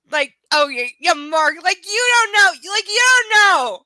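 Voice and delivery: loud, high excited teasing. Real, not acted. Teasing Mark on stream, loud and high. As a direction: needle Mark with rising glee.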